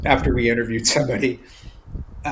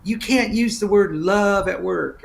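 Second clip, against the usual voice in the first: slow, higher, drawn-out mocking drawl; 7 semitones higher, slower than usual. Attorney at an HR conference scolding people, slow mocking drawl. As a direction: stretch each word in a lazy mock drawl. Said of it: "in this really deep drawl".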